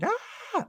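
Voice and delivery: high scream, held back in volume; mock scream. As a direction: imitate a scream without full force. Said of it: "she burns up screaming".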